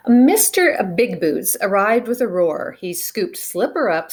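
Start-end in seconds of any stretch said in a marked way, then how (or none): none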